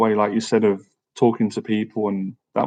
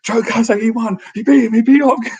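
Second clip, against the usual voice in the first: much higher, louder cry of victory rising; overjoyed tearful outburst; 12 semitones higher, 4 dB louder than usual. Retold or imitated. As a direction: blurt the win out through relieved tears, higher each time. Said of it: "I started crying".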